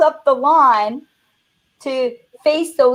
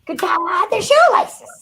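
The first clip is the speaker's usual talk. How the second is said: higher punchline; 7 semitones higher than usual. Retold or imitated. Retold punchline to dad.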